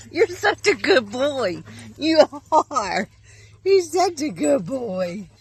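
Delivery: high, loud doggy praise voice; sing-song praise to a dog; pet talk. Real, not acted.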